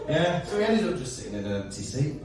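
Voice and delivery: usual speaking voice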